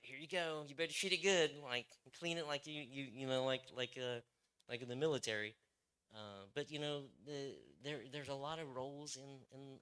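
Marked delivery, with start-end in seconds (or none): 0.0-5.6 louder, higher, bossy handover; mock new guy. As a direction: hand over the rifle with mock swagger. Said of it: "the new guy's like".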